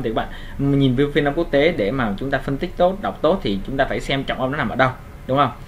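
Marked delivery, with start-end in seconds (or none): none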